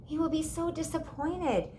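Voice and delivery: sad. A narrator's reading. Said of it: "Sophie said".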